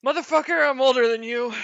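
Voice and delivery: high, loud mock outrage; indignant. Real, not acted. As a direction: fire back, offended.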